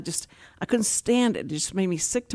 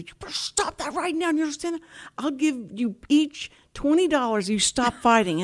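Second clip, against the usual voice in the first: higher, firm scolding voice laying down the law; stern adult warning; 6 semitones higher than usual. Retold or imitated. Woman scolding neighborhood kids to stop fighting, stern and higher. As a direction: talk down to the fighting kids like a strict grown-up. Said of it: "and I'd say".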